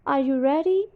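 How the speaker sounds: "Are you ready?" with the voice climbing on "ready"; rising intonation. Shown as a demonstration. Teacher demos a yes-no question, rising tune. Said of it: "rising intonation".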